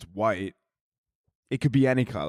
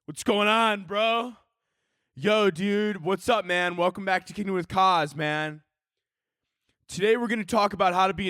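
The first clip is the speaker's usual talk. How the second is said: hyped-up bro host greeting the internet; bro podcast host; 6 semitones higher than usual. Acted. Bro host hyping his fans at the start, higher. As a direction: pump up the bro-show intro.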